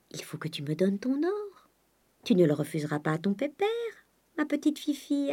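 put-on soft, coaxing baby talk; hushed cajoling, lilting up at the end; wheedling father. A narrator's reading. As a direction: sweet-talk her into handing it over. Father wheedles gold from daughter.

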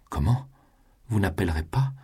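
indignant outburst. Acted. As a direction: flare up in outraged surprise. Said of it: "les yeux étincelants de colère". (éclat d'indignation, plus aigu)

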